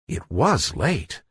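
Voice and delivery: ordinary delivery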